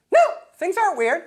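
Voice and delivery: high, loud mock denial; defensive outburst. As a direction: blurt it out as the jumpy girlfriend. Acts girlfriend overreacting to a hello.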